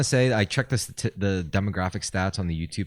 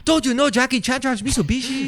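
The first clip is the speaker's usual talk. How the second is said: high-pitched mock Chinese accent; hammed-up Chinese accent, voice way up. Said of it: "Chinese and Japanese accents there".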